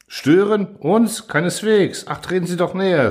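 lion welcoming the frightened camel, exaggeratedly polite; obliging lion. A narrator's reading. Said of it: "sagte der Löwe verbindlich".

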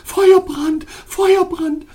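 repeated outcry. A narrator's reading. Ice princess burnt by his touch, shrieking out loud. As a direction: shriek it as if your hand were burnt. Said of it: "immer wieder ausrief".

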